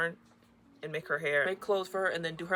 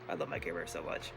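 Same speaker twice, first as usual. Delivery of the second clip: much lower and softer, a deep fond croon; low cooing; 14 semitones lower, 8 dB quieter, faster than usual. Real, not acted. Showing her Care Bears to viewers, low soft croon. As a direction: drop the voice way down fondly.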